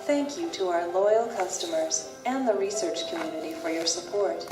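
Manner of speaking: ordinary talk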